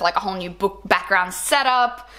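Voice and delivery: ordinary talk, nothing marked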